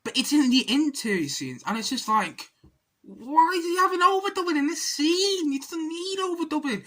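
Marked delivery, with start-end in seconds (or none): 3.0-6.8 high-pitched, incredulous complaint; exasperated. Real, not acted. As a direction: wail it in disbelief at the film. Exasperated mock reaction to the film.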